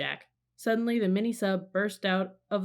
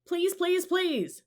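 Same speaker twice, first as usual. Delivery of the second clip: high, louder begging; pleading shout; 12 semitones higher, 4 dB louder, much slower than usual. A narrator's reading. Annie keeps begging the octopus, high pleading shout. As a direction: plead again and again. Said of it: "she shouted".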